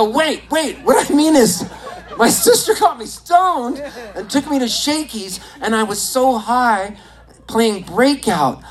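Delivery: high-pitched, giddy backpedalling; flustered excitement. Retold or imitated. Flustered fan explaining himself to his idol, excited. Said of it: "I got so excited".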